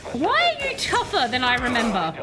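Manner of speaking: ordinary delivery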